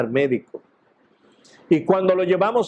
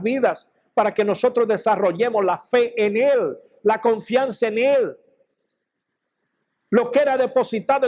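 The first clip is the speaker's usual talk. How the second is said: preaching; 6 semitones higher than usual. Real, not acted. Preacher builds to exhortation.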